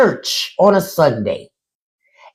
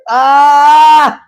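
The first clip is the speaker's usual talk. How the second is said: high loud mock wail; high loud pretend wail in church; mock sob cry; 7 semitones higher, 6 dB louder than usual. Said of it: "that's what you're gonna be doing".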